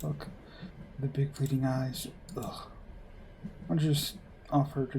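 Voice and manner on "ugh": whispered ugh. Acted. Cringing at the couple.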